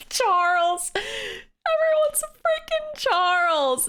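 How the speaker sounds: very high, drawn-out giggly squeal; hysterical squeal. Real, not acted. Streamer cracking up at the running joke, high squeal.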